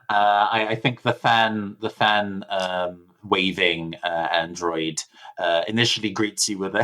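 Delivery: ordinary talk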